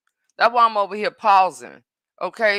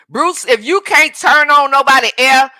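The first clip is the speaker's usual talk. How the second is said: loud, scolding call to Bruce; calling out a commenter; 4 semitones higher, 8 dB louder than usual. Real, not acted. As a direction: tell Bruce to get out, loudly. Kicking a commenter out.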